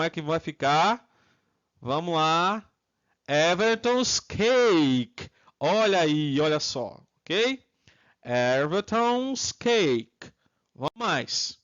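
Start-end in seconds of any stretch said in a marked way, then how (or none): 3.3-5.3 drawn-out, careful teacher's model; slow, stretched-out English answer; slow model pronunciation. Shown as a demonstration. 8.2-10.3 drawn-out, careful teacher's model; slow, stretched-out English answer; slow model pronunciation. Shown as a demonstration. Teacher repeats the model slowly.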